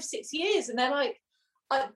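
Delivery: ordinary talk, nothing marked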